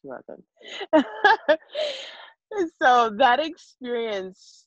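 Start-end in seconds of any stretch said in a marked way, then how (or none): 0.7-2.1 high, light burst of laughter; fond laugh. Real, not acted. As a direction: laugh fondly at the memory. Tender memory of daughter.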